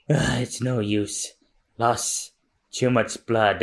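acted exhaustion with heavy pauses; weak and wounded. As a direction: fade as if losing strength from blood loss. Wounded fighter giving out.

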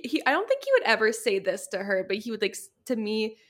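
ordinary talk

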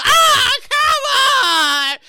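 high, loud whine; mock anguished complaint, drawn out; wailing protest. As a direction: wail it like a child denied a treat. Kid protesting loss of ice cream.

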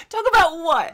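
high, loud laughing echo; laughing mimicry. Host mimicking the guest's "talk about what", laughing high and loud. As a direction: squeal it back through laughter.

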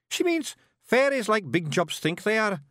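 high Scots voice explaining; tiny know-all Scot; wee Scottish Feegle. A narrator's reading. Voices Awfully Wee Billy.

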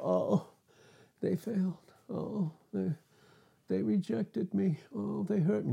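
a lightly voiced pastor moaning to Christ; mock whining pastor. Pastor complaining to Jesus at the Bema seat, mock whimpering. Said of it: "And he would go".

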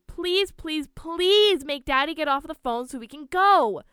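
high, loud pleading whine; wailed, insistent plea; begging. A narrator's reading. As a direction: beg like an impatient child, leaning hard on each please. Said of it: "Lindsay begged to her mother to".

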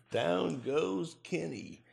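slow, drawn-out fight announcer call. Retold or imitated. Mimics boxing announcer call.

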